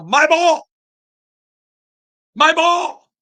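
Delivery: high, loud yell for the ball; big booming high-pitched claim; imitated yell. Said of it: "he'd yell".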